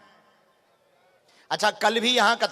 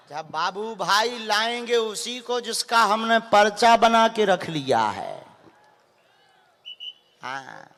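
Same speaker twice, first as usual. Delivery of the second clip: normal talking voice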